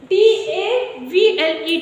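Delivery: letters spelled out very slowly; deliberate classroom spelling. Shown as a demonstration. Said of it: "table".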